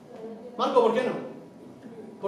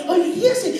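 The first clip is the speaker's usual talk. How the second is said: put-on playful baby talk; 7 semitones higher than usual. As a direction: giggle along with the baby. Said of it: "cómo le hablas". (padre jugando con su bebé entre risas suaves)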